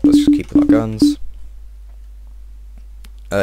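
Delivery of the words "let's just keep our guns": high sing-song. Pretend.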